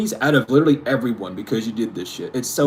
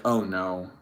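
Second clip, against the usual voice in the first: mock dismay; 6 semitones lower, 9 dB quieter than usual. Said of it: "it's like".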